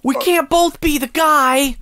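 high cartoon boy voice; squeaky anime rival; high cute anime voice. Playful cartoon role-play.

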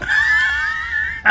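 loud high burst of real laughter; triumphant loud laugh. Real, not acted. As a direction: cackle out loud at the catch. Gleeful at the reveal.

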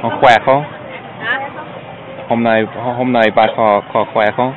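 halting learner Vietnamese, American accent; foreigner broken vietnamese. Real, not acted. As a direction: stumble through the greeting like a beginner. (tiếng Việt bập bẹ của người nước ngoài)

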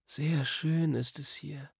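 slow, soft, dreamy; half asleep. A narrator's reading. Diggory nearly dozing off in the quiet wood, drowsy. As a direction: murmur it drowsily, softly. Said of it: "du schläfst schon fast".

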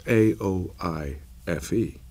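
A O I F E spelled letter by letter, slow and clear; spelling out slowly. Shown as a demonstration. Explaining his daughter's name to the interviewer, spelling slowly. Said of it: "my daughter's called Aoife".

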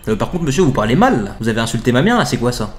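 a lightly voiced call-centre agent, polite and insistent; mock telemarketer, lightly put on; pushy telemarketer. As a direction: sell it like a cheesy phone salesman. Telemarketer offended by caller.

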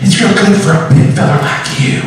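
high, sugary waitress voice; nice waitress. Retold or imitated. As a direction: coo it at a customer like a friendly waitress. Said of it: "real nice waitress".

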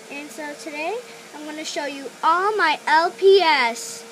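ordinary delivery

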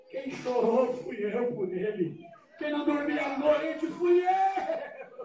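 slow, building to a high stretched cry; impassioned drawn-out plea. Retold or imitated. Father insisting he alone suffered for his son, drawn-out cry. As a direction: stretch each phrase and climb to a wail.

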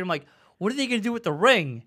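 ordinary talk

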